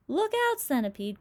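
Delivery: high, loud urgent cry; cried warning. Acted. James warns the falling centipede, loud high cry. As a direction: yell a warning across the deck. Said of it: "cried James".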